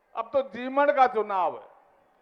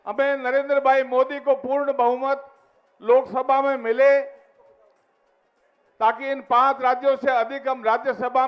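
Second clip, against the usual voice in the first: booming political rally speech; 4 dB louder than usual. Real, not acted. Addressing rally crowd.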